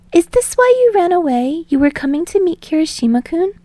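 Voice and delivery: put-on high girlish accusing tone; indignant. A narrator's reading. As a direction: catch him out, affronted. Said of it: "suddenly turns indignant".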